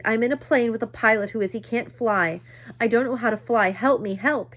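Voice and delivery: put-on boy begging the radio for help; pleading for help. A narrator's reading. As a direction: beg for help, near tears.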